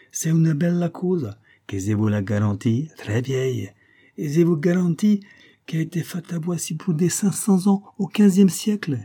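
high-pitched Italian salesman patter; Italian accent. A narrator's reading. Shopkeeper vouching for a necklace to tourists, Italian accent. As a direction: sell it hard in a lilting Italian accent. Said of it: "L'Italien prit le collier en devanture".

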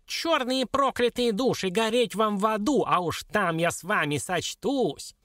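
high, slow dwarf curse; vengeful squeaky little creature; wailing curse. A narrator's reading. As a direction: start high and come down into a threat. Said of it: "завопил карлик".

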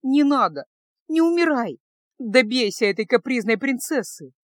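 выше и громче, навзрыд умоляя; плачет и умоляет Кайла не умирать; в слезах. A narrator's reading. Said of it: "заплакала Феска". (плачущая мольба, выше и громче)

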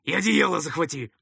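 commanding call into the distance; calling after someone. A narrator's reading. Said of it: "крикнул ему вдогонку второй".

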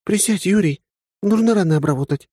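put-on high, earnest young woman's voice; serious young woman. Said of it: "серьёзным видом обратилась она".

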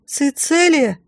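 put-on high, thin-pitched child's call; high feeble child's cry; weak boy's voice. A narrator's reading. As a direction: answer as a frightened little boy. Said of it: "слабым голосом отозвался из-за стены огня мальчик".

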